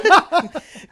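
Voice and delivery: big real laugh, high and loud; laughing hard at a question that gives itself away; loud burst of laughter. Real, not acted.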